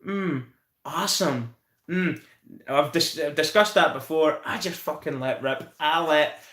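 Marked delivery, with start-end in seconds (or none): 0.1-2.2 drawn-out hums and a slow, lightly American awesome; mock American reactor. Mocking silent American reactors, lightly put-on drawl. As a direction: hum along like a quiet American reactor watching a clip. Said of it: "watching an American where they'll go".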